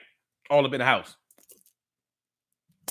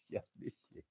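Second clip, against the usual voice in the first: hushed aside; 8 semitones lower, 8 dB quieter than usual. Retold or imitated. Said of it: "And I'd be like".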